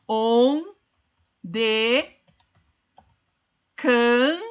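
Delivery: syllable by syllable, long pauses between; drawn-out isolated syllables for scanning a verse; separando sílabas. Shown as a demonstration. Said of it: "Vamos separar". (sílabas separadas, com pausas longas)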